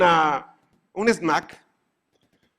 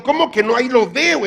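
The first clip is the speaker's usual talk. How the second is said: higher, louder indignant retort; indignant protest; 8 semitones higher, 8 dB louder than usual. Retold or imitated. Customer arguing with the butcher, indignant and louder. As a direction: protest like you can see it right there.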